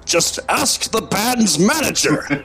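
slow, theatrical rebuke, without real shouting; Gandalf. Acted. Podcaster's joke of a wizard yelling at Bilbo, mock-stern. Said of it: "He's yelling at Bilbo Baggins".

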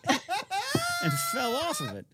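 laughing through the words; cracking up mid-joke. Real, not acted. Teasing friend while laughing.

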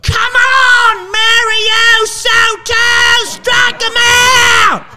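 high-pitched screaming ballpark cheer; Aunt Rhea yelling. Retold or imitated. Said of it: "My Aunt Rhea would go".

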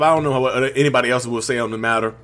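ordinary talk

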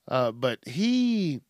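ordinary talk, nothing marked

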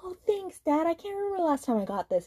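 gushing high voice. Retold or imitated. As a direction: gush with mock emotion. Touched quote, high.

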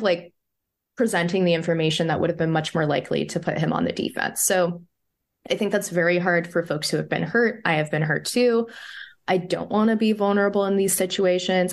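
ordinary talk

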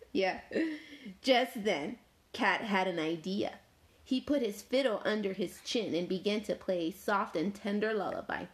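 ordinary delivery

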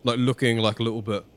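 usual speaking voice

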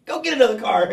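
high, loud mock hype; hyping someone up. Hanger-on urging a star to spend, loudly hyping him. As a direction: egg him on like an eager yes-man. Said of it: "people will just hype them".

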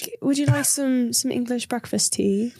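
mock British tea host; British accent. Smoke monster offering breakfast tea, mock British accent. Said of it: "he has a British accent".

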